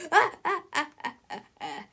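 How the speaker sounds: high mocking cackle; villain's cackling laugh. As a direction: cackle in triumph. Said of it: "laughed Big Tippy".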